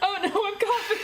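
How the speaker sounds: high, laugh-choked words; laughing fit. Real, not acted. Streamer laughing herself into a cough, real laughing fit. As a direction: laugh until it turns into coughing. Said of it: "she can't keep a straight face".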